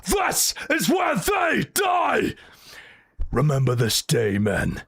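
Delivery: rally the troops before the fight, full voice; epic Spartan speech. Retold or imitated. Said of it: "compare that to a random line in three hundred".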